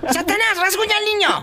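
high-pitched call across the room; calling the cat. Real, not acted. Host teasingly calls the cat across the studio, high call. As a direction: sing it up high to the pet.

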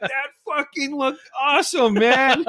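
high gleeful exclamation; amused delight. Retold or imitated. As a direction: imitate a buddy cracking up at the spectacle. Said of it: "Narbona was laughing his".

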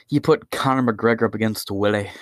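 ordinary talk, nothing marked

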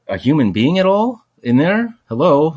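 high, loud call into the booth; retold yelling. Hurt man calling into the security booth, loud yell. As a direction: shout to wake up whoever is inside.

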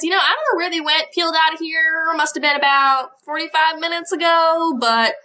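quick Southern waitress twang; gossipy Southern accent. Acted. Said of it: "and she says".

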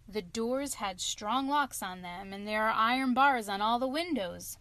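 ordinary delivery

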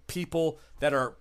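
normal talking voice

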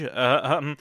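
usual speaking voice

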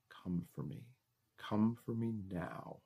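soft intimate whisper, close up; right in your ear. A narrator's reading. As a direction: breathe it into the ear, slow. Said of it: "I whisper in your ear".

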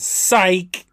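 spring the joke with a lift; gotcha. Acted. Larry revealing his fake-out to friends, gleeful gotcha. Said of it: "Larry tells his friend".

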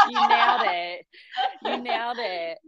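delighted, laughing approval; laughing praise. Real, not acted. Guest applauding what the host just said, laughing. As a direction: laugh through the compliment.

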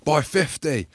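lightly voiced gloating rival; mocking a cocky mate. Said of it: "Goody's like".

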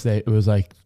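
ordinary talk, nothing marked

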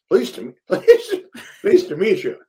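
hammed-up American greeting, laughing through it; American accent. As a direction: put on a bad, high-pitched American accent. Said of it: "you went for a very specific accent".

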